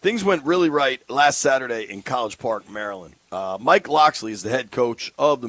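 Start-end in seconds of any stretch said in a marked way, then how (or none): none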